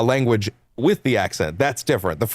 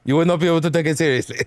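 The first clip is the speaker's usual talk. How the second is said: higher, rapid fake French accent; 6 semitones higher, much faster than usual. French company insists on British voices, fake French accent. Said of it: "my fake-ass French accent".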